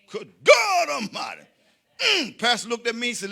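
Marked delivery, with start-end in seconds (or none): 0.1-1.4 high loud preacher exclamation; preacher shout. Acted. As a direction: holler it at the congregation.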